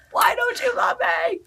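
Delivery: high, drawn-out hammed-up whine; mock wailing. Dog mom to dog across the bed, mock heartbroken wail. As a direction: wail it slowly like a spurned lover.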